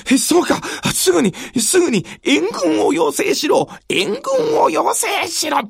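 flustered lord calling for help; panicked shogun. Acted. Shogun panics, orders reinforcements.

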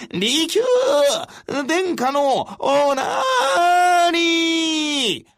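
high, very slow ringing shout; booming call. A narrator's reading. Retainer announcing the lord's arrival, booming drawn-out call. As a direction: call out across the snowy night, drawn out. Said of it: "この声が雪の夜に響き渡った".

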